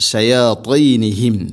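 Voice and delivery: "ഷയാത്തീനിഹിം" slow, tongue pressed up for a dark t; heavy emphatic t. Shown as a demonstration. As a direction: chant "ഷയാത്തീനിഹിം" with a thick, heavy "ത്തീ". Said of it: "ചേർന്ന് നിൽക്കണം". ("ഷയാത്തീനിഹിം" താ കനപ്പിച്ച് ഓതുക)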